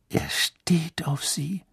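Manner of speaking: slow disbelieving whisper; can't believe what he sees; incredulous whisper. A narrator's reading. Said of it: "sagte Ron ungläubig".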